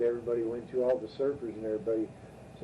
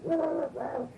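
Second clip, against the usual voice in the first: high mock dog barks, rah rah rah; imitating a man barking like a dog; dog barking imitation; 14 semitones higher, slower than usual. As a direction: bark like a crazed dog, pitched way up. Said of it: "he goes".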